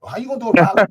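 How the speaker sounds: pitched high and loud in disbelief; can't believe the suggestion; incredulous. Real, not acted. Streamer scoffing at a viewer's rally idea, incredulous.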